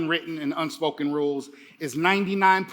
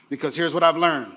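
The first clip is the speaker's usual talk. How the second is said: usual speaking voice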